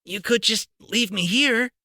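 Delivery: put-on boy's voice, lightly hopeful; a lightly voiced hopeful boy. A narrator's reading. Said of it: "Harry put in hopefully".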